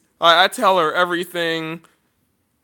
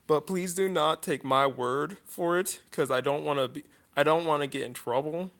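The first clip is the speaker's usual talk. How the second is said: real hushed plea, much softer; keeping it down; 2 semitones lower, 8 dB quieter than usual. Real, not acted. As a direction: lower your volume after being too loud. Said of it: "I feel like I'm being loud".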